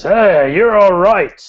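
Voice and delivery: loud, high-pitched hearty bar cheer; hearty toast. Acted. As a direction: raise a big toast to a new pal.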